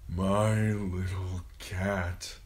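very slow, wispy dying father's voice; thin, pained murmur. A narrator's reading. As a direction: stretch each word weakly, racked by pain. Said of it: "thin and wispy and racked by pain".

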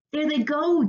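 high, excited cheer. A narrator's reading. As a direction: cheer as the action starts. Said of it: "cheered Naya".

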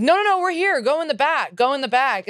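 high, bright call across the yard; hollering directions at the pranked guests. Retold or imitated. Calling out to pranked party guests.

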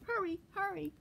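high urging voice; urgent grandmother. A narrator's reading. Grandmother urges squirrel to the burrow, high and urgent. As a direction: hurry him along, pitched high.

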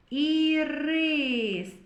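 ірис with a long, high и; и drawn out. Shown as a demonstration. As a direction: stretch out the и in ірис.

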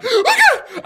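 very high, loud mock shriek; hammed-up panicked squeal, half laughing; John freaking out. Friend panicking at police guns, mock shriek. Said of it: "John starts fucking freaking out".

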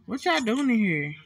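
ordinary talk, nothing marked

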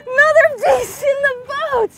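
high, loud and elated; triumphant yell. Real, not acted. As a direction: shout the catch in triumph. Celebrating a fish landed.

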